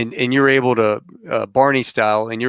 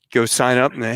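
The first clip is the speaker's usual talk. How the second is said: ordinary talk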